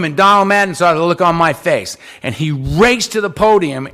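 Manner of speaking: ordinary talk, nothing marked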